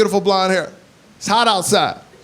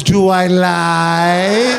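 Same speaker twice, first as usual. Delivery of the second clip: mom's slow jab; much slower than usual. Retold or imitated. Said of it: "Then she looks at me and my wife and goes".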